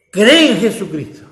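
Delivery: loud, driving call to trust with all your heart; fervent preaching. Real, not acted. Preacher urging the congregation to trust Jesus, loud and fervent. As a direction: build each "con toda tu" into a rising exhortation.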